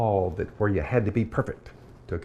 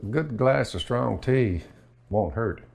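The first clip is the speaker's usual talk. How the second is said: ordinary talk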